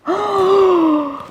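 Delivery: high frightened gasp; storyteller's gasp; sharp gasp. Acted. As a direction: gasp in alarm.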